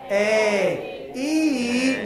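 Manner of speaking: loud, open A then closed E; vowel drill, mouth wide; exaggerated vowels. Shown as a demonstration. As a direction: shape each vowel big and clear. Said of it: "A is very open".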